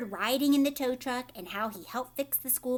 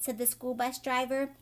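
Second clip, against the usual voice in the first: plain reading voice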